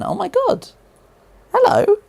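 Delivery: very high, loud surprised squeal; excited squeal. Real, not acted. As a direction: shriek with delight at the gift. Reacts to foreign currency donation.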